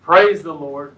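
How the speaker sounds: loud, ringing scripture proclamation; proclaimed shout. A narrator's reading. Reader voices a vast crowd in heaven, proclaimed shout. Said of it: "a vast crowd in heaven shouting".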